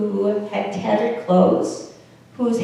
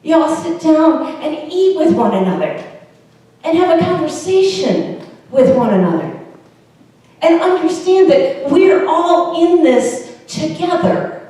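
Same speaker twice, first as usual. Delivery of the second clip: lightly voiced rallying call, raised pitch, louder; Jesus proclaiming welcome; 7 semitones higher, 5 dB louder than usual. Jesus inviting everyone to sit and eat together, proclaiming. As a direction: call the crowd in, high and emphatic. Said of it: "Jesus is saying".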